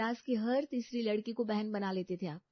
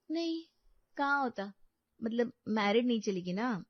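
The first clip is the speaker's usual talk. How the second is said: flustered denial; 5 semitones higher, 3 dB louder than usual. Acted.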